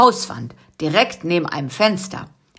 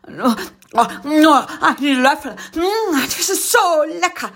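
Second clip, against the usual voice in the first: begging; 9 semitones higher than usual. Acted. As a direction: beg with your mouth watering. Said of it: "bettelte Karl-Heinz".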